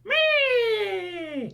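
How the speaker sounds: very high tiny squeak; pet squeak. Pretend.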